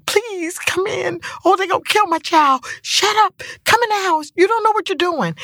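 high, pleading mock cry of a scared mother; frantic crying mom. Mother on the porch begging her child to come inside, high frantic wail. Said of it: "My mom is on the porch crying".

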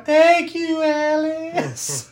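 high, drawn-out floaty voice; sing-song it like spirits flying past; mock grateful souls. Host imitates freed souls flying past Alice, high mock-ethereal thanks. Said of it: "all the souls fly past Alice going".

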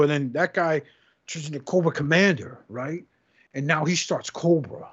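ordinary talk, nothing marked

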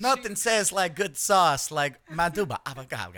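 a lightly voiced Italian sauce ad, laughing; sauce commercial voice. Teasing her friend with a mock sauce ad, laughing. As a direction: pitch the line like a cheesy food ad. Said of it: "that sounded like you were doing a sauce commercial".

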